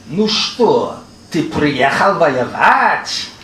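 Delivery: put-on drawn-out Yiddish-accented question, swooping up on the last word; slow, teasing imitation of a Yiddish accent; mock Yiddish accent. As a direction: ask it slowly in an exaggerated Yiddish accent.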